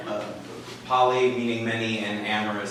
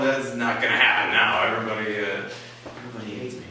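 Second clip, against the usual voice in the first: resigned self-pity; 4 semitones lower, faster than usual. Retold or imitated. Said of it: "resigned myself".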